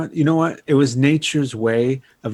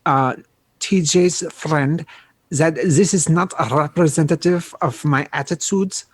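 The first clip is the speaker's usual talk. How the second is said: indignant put-on German accent, pitched up; 4 semitones higher than usual. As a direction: make a formal protest in mock German. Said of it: "you can do that accent".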